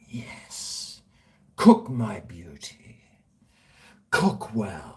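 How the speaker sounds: breathy mock-reverent murmur; hushed gloating whisper. Talking to his steak on the barbecue, hushed whisper. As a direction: whisper to the burning steak like a pet.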